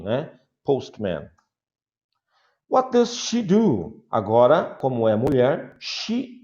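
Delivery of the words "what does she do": asking voice. Shown as a demonstration. Teacher asking model question.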